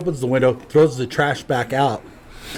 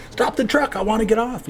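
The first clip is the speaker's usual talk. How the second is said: put-on pleading in a much higher voice, like a scared kid; high mock plea; 9 semitones higher than usual. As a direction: squeak it up high, begging to get off. Retold plea to the driver.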